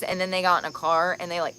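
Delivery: ordinary talk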